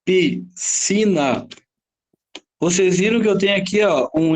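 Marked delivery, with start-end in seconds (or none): 0.0-1.5 Piscina drawn out very slowly, syllable by syllable; slow demonstration. Shown as a demonstration. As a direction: stretch Piscina out for the class. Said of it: "que que eu vou fazer".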